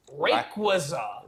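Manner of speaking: slow flat robot voice saying Rayquaza. Retold or imitated. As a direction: Rayquaza read like text to speech. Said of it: "the robot goes".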